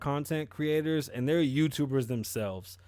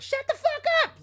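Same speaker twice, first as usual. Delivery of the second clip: very high screeched yell; shrill mock shriek, louder; background yelling; 5 dB louder than usual. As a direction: imitate a woman screaming from the background. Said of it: "that bitch in the background".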